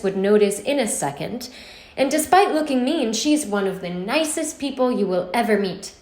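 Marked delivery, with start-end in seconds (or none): none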